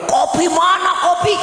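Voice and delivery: high mock shout for coffee; demanding husband. Husband banging the table at his wife, high angry shout. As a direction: bellow like a husband slamming the table.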